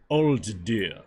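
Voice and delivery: attempted Russian accent. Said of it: "How do I do a Russian accent?".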